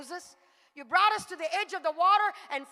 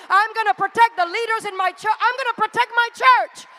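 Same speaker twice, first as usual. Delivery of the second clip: louder, higher preaching that keeps building; full-force preaching; 4 semitones higher, 8 dB louder than usual. Acted. Preacher rallying the congregation to protect their church, full force, building.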